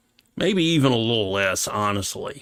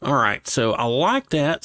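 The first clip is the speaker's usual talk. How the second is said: usual speaking voice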